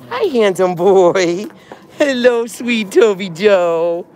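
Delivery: sweet pet-talk voice; cooing at the dog. Real, not acted. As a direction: coo lovingly at your pet.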